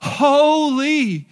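loud, high, long HOLY; HOLY cried out high and stretched; screaming at full lungs. Shown as a demonstration. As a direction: belt out HOLY like all of heaven shouting. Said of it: "screaming at the top of their lungs".

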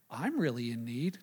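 deadpan it low and quiet, like a poor soul; mock needy joke. Pastor joking to the congregation, mock pitiful and low. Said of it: "No, I'm just kidding".

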